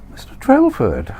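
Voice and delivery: very high, bright greeting; Candy's high voice. Acted. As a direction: call his name sweetly.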